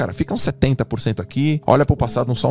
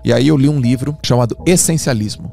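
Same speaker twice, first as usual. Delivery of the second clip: usual speaking voice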